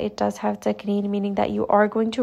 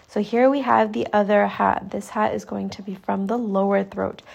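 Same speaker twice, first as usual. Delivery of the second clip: ordinary talk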